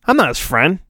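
normal talking voice

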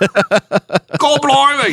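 slow, high hammed-up British exclamation; a silly stage-British gasp; mock British accent. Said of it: "read it in a British accent".

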